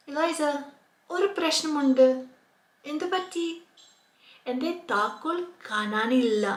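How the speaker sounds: acted dialogue. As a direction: play both friends searching for keys.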